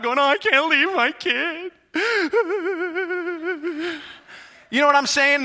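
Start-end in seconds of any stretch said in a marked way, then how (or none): 0.2-1.7 high, drawn-out wail of a panicking mom; exaggerated tearful panic; mock freaking out. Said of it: "she's freaking out". 1.9-4.1 high, quavering fake wail; hammed-up crying of a distraught mom; long, wobbly pretend sob; mock sobbing. Imitates the mother's sobbing.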